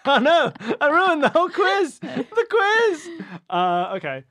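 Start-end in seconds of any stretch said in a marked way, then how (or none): 0.0-3.1 high, loud cry through laughter; real laughing outburst, loud and high-pitched; laughing wail. Real, not acted. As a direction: wail it while cracking up. Laughing at spoiling the answer.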